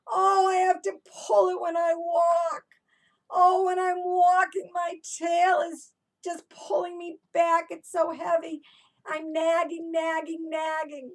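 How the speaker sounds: high, loud whiny moaning dragon; complaining dragon. Acted. Teacher voices Debbie the dragon complaining, high loud whine. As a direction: moan and whine like a dragon dragging her heavy tail. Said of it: "Debbie the dragon was complaining all the time".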